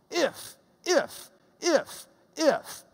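IF popped high, one at a time; a separate high punch on every IF; punchy repeated IF. Shown as a demonstration. Teacher shows how each clause starts, punched IF. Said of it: "the punch that Paul had".

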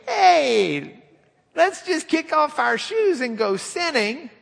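high, loud cheerful invitation; hammed-up eager sinner; mock carefree sinner. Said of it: "if any of you are saying".

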